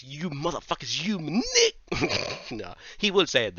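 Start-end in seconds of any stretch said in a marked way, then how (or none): 0.0-1.8 hammed-up villain losing it, pitch climbing high; mock outburst, rising to a loud peak; snapping in anger. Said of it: "Then he snaps, he goes". 1.8-2.5 short breathy chuckle; amused laugh. Real, not acted. Laughs at own joke.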